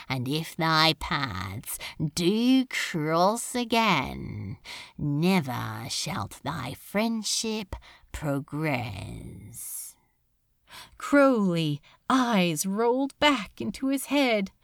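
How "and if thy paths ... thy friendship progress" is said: very slow, low and soft; low, fading dark curse. A narrator's reading. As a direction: seal the curse, slow and quiet. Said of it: "grave and powerful with dark magic".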